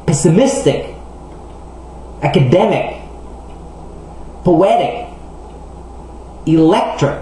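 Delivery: "Economic" to "Electric", each stressed just before the final "ic"; second-to-last syllable stress. Shown as a demonstration. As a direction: punch the penultimate syllable, as in "Economic", "Poetic". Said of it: "sont habituellement accentués sur l'avant-dernière syllabe". (de « Economic » à « Electric », accent sur l'avant-dernière syllabe)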